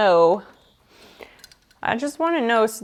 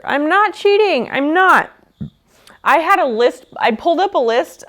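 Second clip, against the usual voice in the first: higher and louder, insisting she's innocent; defensive protest; 5 semitones higher, 3 dB louder than usual. Real, not acted. Streamer denies cheating to chat, raised indignant protest.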